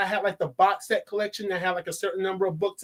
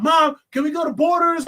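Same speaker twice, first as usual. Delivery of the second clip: put-on higher, eager kid asking mom; 8 semitones higher, 4 dB louder than usual. Kid asking mom for a new book release trip, eager. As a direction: plead like an excited kid. Younger self begging mom.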